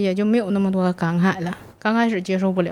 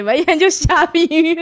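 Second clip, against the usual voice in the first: slow, louder, higher mock scolding; scolding remark; 8 semitones higher, 6 dB louder, slower than usual. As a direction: quote Sister Wei's rebuke. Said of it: "薇姐就说我". (模仿薇姐慢慢地数落)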